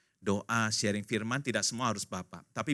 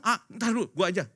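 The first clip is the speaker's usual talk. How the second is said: higher, louder mock cut-in; impatient interruption; 7 semitones higher, 5 dB louder than usual. Spouse interrupting papa who wants to talk, impatient. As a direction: brush him aside to take over.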